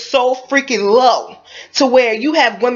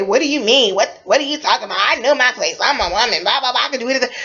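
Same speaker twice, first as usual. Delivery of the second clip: mocking quote; faster than usual. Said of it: "a lot of people who say".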